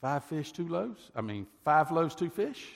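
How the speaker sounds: stunned, can't make the numbers work; incredulous disciples. Acted. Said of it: "they look at him and say".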